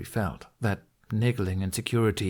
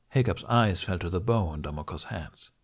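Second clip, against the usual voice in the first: usual speaking voice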